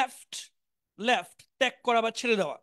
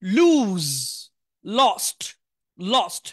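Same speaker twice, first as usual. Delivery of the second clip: লুজ and lost called out louder; loud drill; 3 semitones lower, 6 dB louder, much slower than usual. Shown as a demonstration. Teacher calling out verb forms loudly to students. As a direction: project the words to the class. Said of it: "মনোযোগ দিয়ে শুনবেন".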